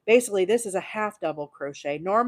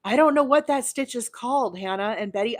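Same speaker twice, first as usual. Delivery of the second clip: excited; 6 semitones higher, 5 dB louder than usual. Real, not acted. Gushing over new stitch.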